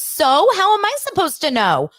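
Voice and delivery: hammed-up shrill retort; outraged Larsa mimic. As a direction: fire it back like a defensive Larsa. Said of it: "And Larsa goes".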